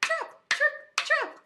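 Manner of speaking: high, short rhythmic hoof beats; hoof sounds. Acted. Storyteller making little goat's hoofs go, high. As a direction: chant each step high and crisp. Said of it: "his hoofs went".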